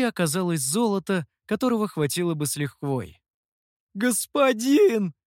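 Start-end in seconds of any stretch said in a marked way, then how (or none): 3.9-5.1 high, drawn-out, loud delighted call; gleeful, stretched exclamation; overjoyed. A narrator's reading. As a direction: hail a guest with sudden delight. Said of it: "снова обрадовался хозяин".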